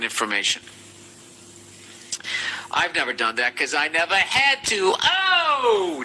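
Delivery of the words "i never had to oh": brag, then let out a drawn-out high "oh"; mock boastful whoop. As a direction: build into a long, high whoop.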